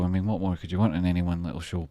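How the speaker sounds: ordinary delivery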